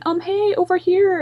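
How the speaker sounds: high-pitched mock calling out; lightly shouted impression of her; imitated shout. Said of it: "like, she's shouting".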